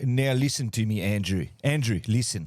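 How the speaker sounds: mock stern voice.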